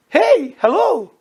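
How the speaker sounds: high, loud, slow hailing; sharp call-out. Acted. Preacher breaks in on the grudge-holder, calling out loudly. Calling out to rebuke the offended person.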